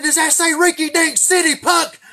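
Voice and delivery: put-on high, loud furious bark at a kid; screaming state trooper; angry trooper yell. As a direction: blow up at the boy like an outraged trooper. Trooper yelling after bribe.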